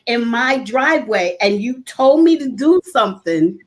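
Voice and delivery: ordinary talk, nothing marked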